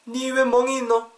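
high-pitched, startled questioning; alarmed dialect question. Acted. Friend asking about a bruise, high alarmed dialect. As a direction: demand an explanation in Korean dialect.

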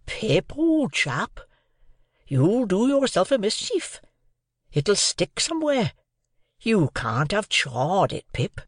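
high, swooping voice of worried Joe; serious remonstrance. A narrator's reading. Joe warning Pip about his food, in serious remonstrance. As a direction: remonstrate earnestly as a simple countryman. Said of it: "in very serious remonstrance".

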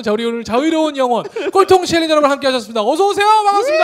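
loud, high, fast showman greeting; excited welcome. Real, not acted. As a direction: hype up the crowd. Host greets studio audience.

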